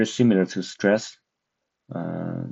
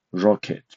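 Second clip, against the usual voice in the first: "rocket" with the first syllable stressed. Shown as a demonstration. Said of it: "stress is on the first syllable".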